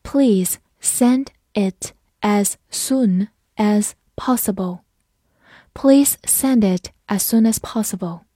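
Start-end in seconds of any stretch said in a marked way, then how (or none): 0.0-4.8 slow, deliberate read; slow classroom model sentence. Shown as a demonstration. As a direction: stretch every word for learners. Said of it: "好，跟着我慢读一遍。".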